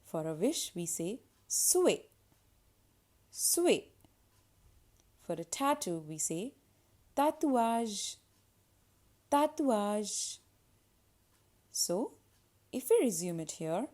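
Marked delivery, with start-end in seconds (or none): 3.3-3.8 "souhait" as sway, with a w glide; little w sound. Shown as a demonstration. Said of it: "a little w sound". 7.1-10.4 "tatouage" as ta-twazh; little w sound. Shown as a demonstration. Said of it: "a little w sound".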